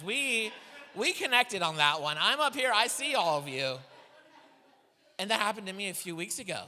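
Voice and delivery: ordinary delivery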